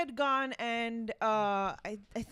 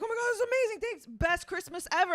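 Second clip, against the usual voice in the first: excited; 6 semitones higher, 3 dB louder, faster than usual. Retold or imitated. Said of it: "he's like".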